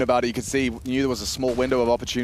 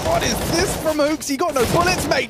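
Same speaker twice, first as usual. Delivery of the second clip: much higher, disbelieving call; excited caster; 13 semitones higher, 4 dB louder than usual. Real, not acted. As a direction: shriek it in amazement. Caster reacts to wild play.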